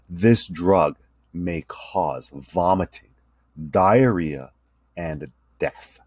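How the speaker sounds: very slow, drawn-out disclaimer. Shown as a demonstration. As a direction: stretch every word with long pauses. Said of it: "was slowed down".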